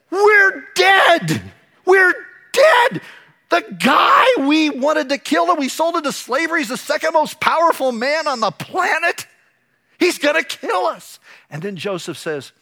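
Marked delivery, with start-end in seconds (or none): 0.1-11.2 much higher and louder, a terrified cry; panicked shout. Retold or imitated. Brothers panicking in front of Joseph, terrified shout. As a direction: yell it like you're about to die.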